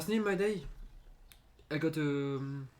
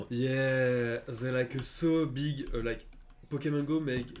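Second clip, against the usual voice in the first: excited; 7 dB louder, slower than usual. Real, not acted. Excited about update.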